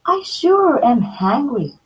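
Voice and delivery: put-on high, eager girl's voice; a hungry little girl; Goldilocks, little girl voice. Girl at the bears' table, high hungry voice. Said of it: "Goldilocks said".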